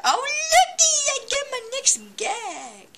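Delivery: hammed-up kid thrilled by a prize; mock excited squeal. Gamer mock-celebrating a new gag, high squeal.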